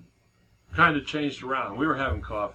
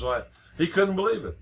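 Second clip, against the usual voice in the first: normal talking voice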